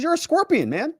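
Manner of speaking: very high-pitched mock disbelief; incredulous frog. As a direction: play the frog stating the obvious, voice jumping up. Voices incredulous frog in fable.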